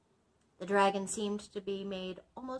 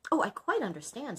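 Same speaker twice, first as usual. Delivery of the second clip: wife; 7 semitones higher, 7 dB louder than usual. Acted. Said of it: "said his wife".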